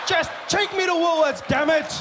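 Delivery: frustrated shout. Acted. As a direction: yell it in frustration. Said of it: "fighting with your car".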